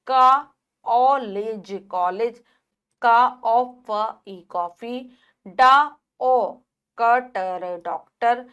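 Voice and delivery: slow syllable-by-syllable spelling of ऑ words; slow ऑ spelled-out demo. Shown as a demonstration. Teacher to children, spelling English loanwords with ऑ slowly. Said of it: "जैसे का".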